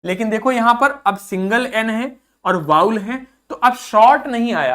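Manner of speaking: usual speaking voice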